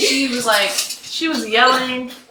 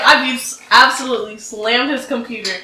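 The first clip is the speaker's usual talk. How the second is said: normal talking voice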